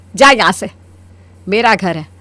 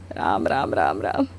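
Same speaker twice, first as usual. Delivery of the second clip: much softer, low scornful muttering; disgusted muttering; 12 dB quieter than usual. Acted. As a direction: mutter it under your breath in disgust. Mother scorns modern daughter-in-law. (घृणा से बुदबुदाते हुए)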